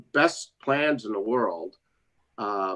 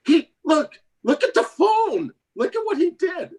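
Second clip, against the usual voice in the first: excited disbelief; 11 semitones higher than usual. Retold or imitated. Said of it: "He says".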